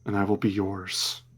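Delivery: soft, low hushed plea of a sword; quiet whispering sword voice. Acted. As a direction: murmur it like a sentient blade. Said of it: "the sword whispers to Euphemion".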